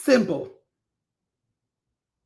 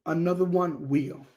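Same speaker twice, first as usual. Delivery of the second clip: softer, settled delivery; subdued restatement; 3 semitones lower, 6 dB quieter than usual. Real, not acted. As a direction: let it drop quietly, matter-of-fact. Same catchphrase repeated, now subdued.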